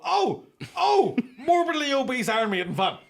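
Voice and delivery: high, loud mocking gloat through laughter; gleeful jeering with a laugh; mock gleeful discovery. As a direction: crow over finding his profile.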